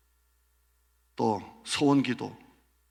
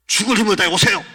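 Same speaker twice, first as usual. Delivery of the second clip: much higher, loud command; pastor yelling; 9 semitones higher, 14 dB louder, faster than usual. Retold or imitated. Pastor shouting at the member to come anyway. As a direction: bellow it like an order. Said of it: "목소리가 심각해집니다".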